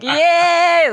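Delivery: high, loud gleeful cheer; excited yay. Retold or imitated. Telling a friend she got to drive the Lada, a loud happy cheer. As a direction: squeal it like a kid offered a treat. Retelling joy at being offered drive.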